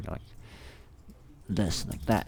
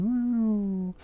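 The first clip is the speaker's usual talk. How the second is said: mock cheer; 14 semitones higher than usual.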